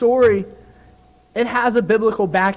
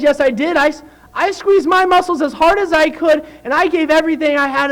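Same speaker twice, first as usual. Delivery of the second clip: high, insistent mock protest; frustrated child; 6 semitones higher than usual. Little girl insisting to dad she tried, acted frustration. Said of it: "extremely frustrated".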